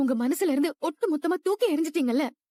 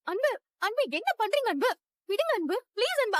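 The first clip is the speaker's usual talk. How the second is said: panicked pleading; 7 semitones higher, 3 dB quieter than usual. Acted. Woman pushed away, pleading.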